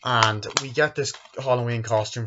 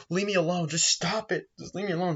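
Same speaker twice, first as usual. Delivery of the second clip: mock pleading child; 9 semitones higher than usual. Retelling bullied Jamie pleading.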